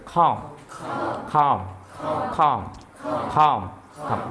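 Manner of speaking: খম with air pushed from inside the throat; airy throat kh. Shown as a demonstration. Teacher drills pupils to repeat, airy throaty খম. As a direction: open the throat and breathe out খম. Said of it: "ভিতরে গল গলে হইয়া বাতাসটা".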